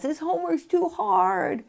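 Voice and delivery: put-on high, complaining kid voice; whiny child. Parent imitates a child stuck on homework, high and whiny. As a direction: whine it like a frustrated child. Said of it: "if a kid says".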